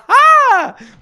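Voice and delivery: high burst of laughter; loud laugh. Real, not acted. Big laugh.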